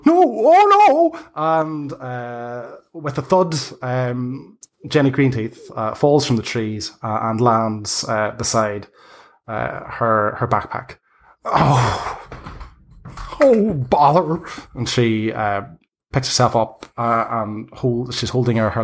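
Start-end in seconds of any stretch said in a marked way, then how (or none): 0.1-1.2 high, loud, drawn-out cry as she falls; a squealing old hag losing her grip; slow, high panicked wail; falling cry. Acted. 11.4-14.4 put-on high, cross old hag voice; a crone fussing after a fall; mildly irritated old woman; flustered hag. Hag lands beside her backpack.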